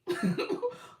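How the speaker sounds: a real high giggle that rises. Real, not acted. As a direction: let a squeaky giggle slip out. Laughs at chat question.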